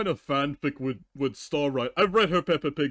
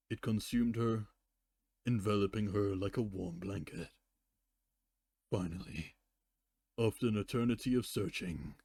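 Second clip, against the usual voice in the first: story narration; 5 semitones lower, 9 dB quieter than usual. A narrator's reading. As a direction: fade the story out gently.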